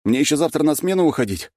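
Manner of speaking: high, exasperated protest; loud, worn-out grumble; exhaled retort. A narrator's reading. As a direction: scold while catching your breath. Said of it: "выдохнул я".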